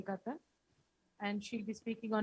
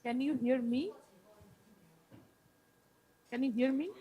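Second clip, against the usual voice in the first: louder calling out; to someone hard of hearing; 2 semitones higher, 5 dB louder than usual. Real, not acted. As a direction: call out to be heard on the line. Testing if heard on call.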